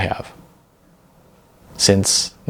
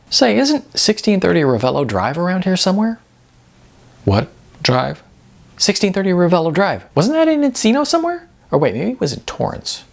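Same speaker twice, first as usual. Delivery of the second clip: higher, eager rush of recognition; excited; 10 semitones higher than usual. A narrator's reading. Sean to Mike in the van, suddenly excited. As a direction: pitch jumps up, bubbling with the idea.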